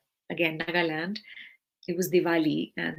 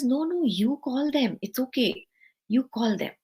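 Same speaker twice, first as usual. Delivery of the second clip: gently insisting; 5 semitones higher than usual. Retold or imitated. Guru insists she call.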